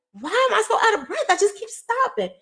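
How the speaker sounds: high-pitched baffled whine; exasperated disbelief. Retold or imitated. Woman recalls wondering why she kept stopping, high and bewildered. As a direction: complain in a squeaky, bewildered voice.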